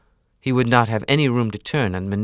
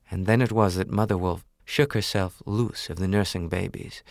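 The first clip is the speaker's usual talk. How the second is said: ordinary delivery, nothing marked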